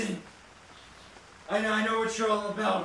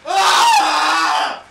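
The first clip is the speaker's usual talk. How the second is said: loud, high yell of fright; startled scream; 7 semitones higher, 13 dB louder than usual. Real, not acted. Player hit by a jump scare, screaming.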